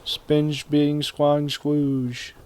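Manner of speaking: very slow nonsense drawl; mangled name. Pretend. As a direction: stretch out silly gibberish.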